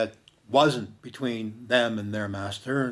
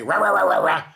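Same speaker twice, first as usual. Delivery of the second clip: loud, higher yapping barks; imitated dog bark; 7 semitones higher, 6 dB louder, faster than usual. Hurt dog barks fiercely at the stranger, loud yapping bark. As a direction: bark it out like an angry little dog. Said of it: "And he barked at me".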